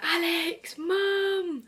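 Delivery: high-pitched call for help, reenacted; retold shout. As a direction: call out to family in the next room. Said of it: "So I just shouted".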